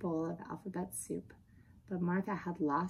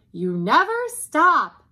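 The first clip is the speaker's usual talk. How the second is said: loud, high, drawn-out accusation; mother's yell; 9 semitones higher, 14 dB louder, slower than usual. Acted. Mother to the talkative dog, yelling. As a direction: yell it slowly, voice raised high. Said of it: "yelled Mother".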